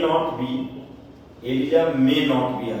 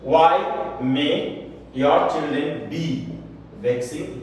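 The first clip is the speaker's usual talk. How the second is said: very slow, spaced words; slow dictation; much slower than usual. Shown as a demonstration. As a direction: dictate for notes. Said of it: "teacher repeats the question". (धीमी लिखवाने वाली आवाज़)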